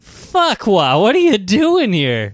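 high, loud, slow exclamation; hammy shocked outburst. Acted. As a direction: yell it like a startled character.

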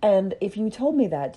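ordinary talk

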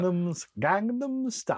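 put-on high Brummy accent, slipping from Yorkshire. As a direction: loose Birmingham accent. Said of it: "I think I'm doing Brummy there".

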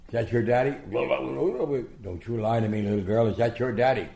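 playfully bully out the question; mock interrogation. Boxer holding little girl overhead, loud mock interrogation.

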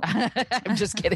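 real giggle through the words; laughing. Real, not acted. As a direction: laugh while admitting the joke. Laughs at her own exaggeration.

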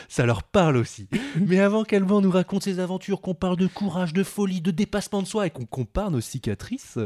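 punchy scripted podcast introduction; show intro read. Acted. Host introducing guest Alban to listeners, presenting.

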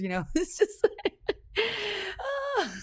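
laughing high and breathless; giggling through the words; real laughter. Real, not acted. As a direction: laugh it off at yourself. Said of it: "I can laugh about it now".

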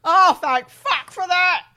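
loud, slow overdone relief; mock relief. Fake victim hearing the money is safe, loud mock relief. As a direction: gasp it out in huge relief.

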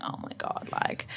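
hushed, voiceless amazement; awed whisper. Retold or imitated. Viewer watching the athlete's videos, awed whisper. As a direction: whisper it as if stunned.

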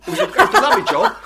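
high, fast, gushing mock voice; a hammed-up chirpy cameraman; twee crew member.

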